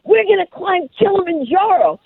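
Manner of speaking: high, eager announcement; excited mom. Retold or imitated. Mom bursting into son's room, high and excited. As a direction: burst in with big news. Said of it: "I run into my son's room".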